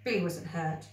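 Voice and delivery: usual speaking voice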